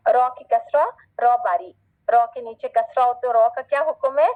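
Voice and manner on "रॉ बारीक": ordinary delivery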